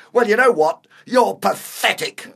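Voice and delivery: high and loud, then drawn out on the verdict; furious insult. Acted. Older man condemning his housemate, furious. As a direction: build up to a scornful blow.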